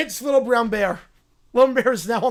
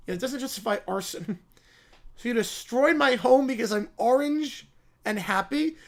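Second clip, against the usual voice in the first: ordinary talk, nothing marked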